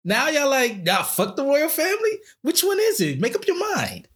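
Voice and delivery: high-pitched, incredulous comic retort; mock exasperation. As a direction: call out their flip-flop in a high voice. Acted retort to fickle Twitter crowd.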